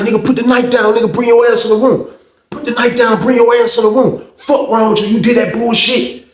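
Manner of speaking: high, insistent acted-out demand; retold heated challenge. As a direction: imitate a man daring another to fight.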